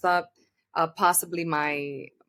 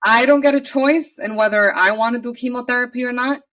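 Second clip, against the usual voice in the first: louder, higher and indignant; raised indignant voice; upset protest; 5 semitones higher, 5 dB louder than usual. Retold or imitated. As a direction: push back against the unfairness. Protest to receptionist, crying.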